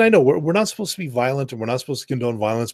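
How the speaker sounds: normal talking voice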